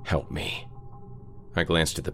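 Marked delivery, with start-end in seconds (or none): 0.0-0.7 soft, half-whispered remorse; a quiet, broken apology; hushed and guilty. Acted. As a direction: confess it softly, ashamed and scared. Carl hushed, guilty on the phone.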